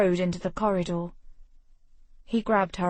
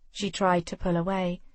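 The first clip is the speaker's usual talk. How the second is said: usual speaking voice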